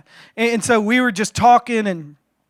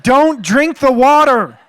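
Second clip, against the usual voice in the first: loud, slow, higher-pitched yell; calling out warning; 4 semitones higher, 6 dB louder, slower than usual. Retold or imitated. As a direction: holler a drawn-out warning to a kid. Calling out to child in sewage.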